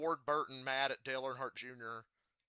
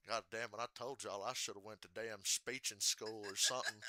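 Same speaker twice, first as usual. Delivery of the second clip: Ward Burton. Retold or imitated. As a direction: grumble it like a country Virginian. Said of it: "He says some shit like".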